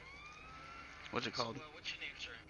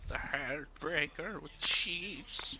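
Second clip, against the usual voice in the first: lightly voiced old sage, slow and soft; drawn-out old storyteller, only a hint; Deckard Cain voice; 3 semitones higher, 11 dB quieter, slower than usual. Shown as a demonstration. Showing how he'd roleplay, a hint of Deckard Cain. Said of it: "I would do the Deckard Cain voice".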